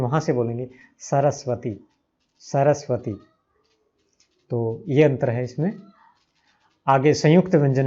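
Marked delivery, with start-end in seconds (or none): 1.0-3.1 सरस्वती with a crisp dental s, tongue at the teeth, said softer; dental s demo. Shown as a demonstration. Teacher modelling the dental s for learners, softly and carefully. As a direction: say सरस्वती with both s sounds from the teeth. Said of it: "सब दांतों से बोले जाते हैं".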